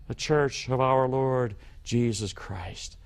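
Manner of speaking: ordinary talk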